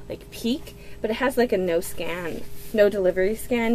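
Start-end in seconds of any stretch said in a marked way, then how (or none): 0.1-0.8 whisper. Real, not acted. Tempted to peek, hushed.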